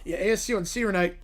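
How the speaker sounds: ordinary talk, nothing marked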